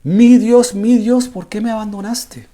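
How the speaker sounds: high, loud desperate outcry; Jesus crying out from the cross, loud and high; anguished cry. Retold or imitated. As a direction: cry it out to heaven, then drop away. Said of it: "diciéndole".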